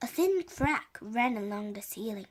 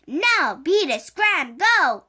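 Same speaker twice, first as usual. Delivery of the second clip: loud, punchy string of orders; yelling; 5 semitones higher, 7 dB louder, slower than usual. A narrator's reading. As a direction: shoo it off with raised, higher voice. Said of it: "Jack yelled at it".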